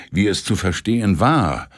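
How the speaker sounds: plain reading voice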